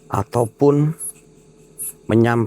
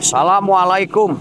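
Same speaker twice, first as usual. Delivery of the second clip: calling to the spirit; 11 semitones higher than usual. Real, not acted. Ghost hunter calling the unseen being, high ringing call.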